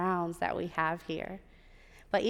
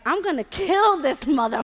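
loud, higher-pitched acted rage; furious outburst; 7 semitones higher, 10 dB louder than usual. Slapped manager raging at her program director, furious. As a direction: explode into a threat.

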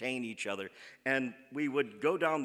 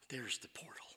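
hushed secret whisper; whispering in awe at the hidden way in; 2 semitones lower, 13 dB quieter than usual. Retold or imitated. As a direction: breathe it like a discovery in the dark. Sneaking in the dark, stealth mode.

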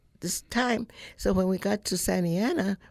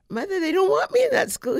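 put-on upset child to mother; 14 semitones higher, 6 dB louder than usual. Child tells mother after crying.

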